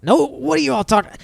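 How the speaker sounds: high offended protest; indignant. Acted. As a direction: squawk in wounded pride. Indignant outburst at teasing.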